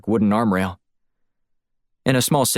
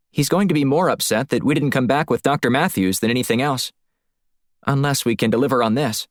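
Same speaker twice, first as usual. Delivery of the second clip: higher, lively woman's voice; reasoning female colleague; 6 semitones higher than usual. Acted. Woman explaining to her partner why Blunt wasn't told, higher and insistent. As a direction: pitch it up as a female partner making her case.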